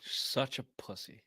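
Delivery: breathy voiceless aside, slow; whispered jab. Real, not acted. Host mocking sick cohost in a whisper. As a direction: whisper it under your breath, teasing.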